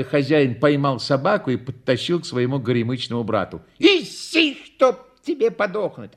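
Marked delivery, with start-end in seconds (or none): 3.8-6.1 high, exasperated scolding; cross cursing. A narrator's reading. Clown curses his disobedient dog, exasperated. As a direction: curse the dog in a raised pitch.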